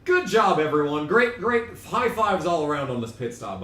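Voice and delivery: high and loud at first, easing down; sarcastic cheering. Real, not acted. Streamer congratulating the pit crew after disaster, loudly sarcastic.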